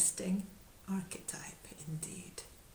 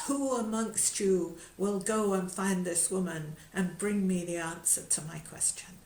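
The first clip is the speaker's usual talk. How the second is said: louder, ringing challenge to the knights; rousing royal call; 6 dB louder than usual. Acted. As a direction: call for a volunteer like a king. King springs up to call for volunteer.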